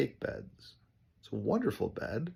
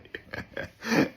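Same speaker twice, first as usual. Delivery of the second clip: laugh. Real, not acted. Laughs after joke.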